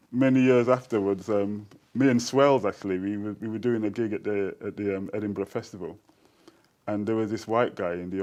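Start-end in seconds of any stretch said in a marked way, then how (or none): none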